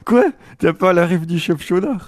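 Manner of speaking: can't believe they missed the reference; shocked question to a friend; incredulous. Real, not acted. Friend doesn't know the reference.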